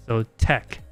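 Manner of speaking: ordinary delivery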